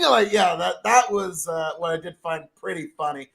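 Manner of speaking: ordinary delivery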